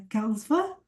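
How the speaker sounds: usual speaking voice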